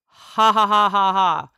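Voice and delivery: loud, evenly spaced ha-ha-ha; recited laugh. Acted. Streamer reading out their own message, loud recited laugh. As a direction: belt out a typed-out laugh syllable by syllable. Reads typed laughter aloud.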